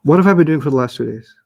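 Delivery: fake-upset teacher scolding; playfully acting betrayed; mock outrage. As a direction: pretend shock at the class. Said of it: "I was just messing with you".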